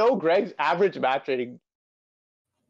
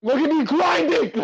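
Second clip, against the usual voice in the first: high excited shout; excited boast; 9 semitones higher, 4 dB louder than usual. Real, not acted. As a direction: crow about grinding hard.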